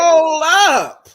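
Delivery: loud, high indignant stop. Retold or imitated. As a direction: cut him off in outrage. Reacting to his rejection.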